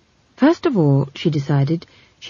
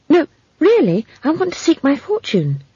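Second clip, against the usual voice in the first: put-on very high, earnest child's voice; insistent child; 11 semitones higher, 3 dB louder than usual. A narrator's reading. Girl refusing to be sent home, insistent.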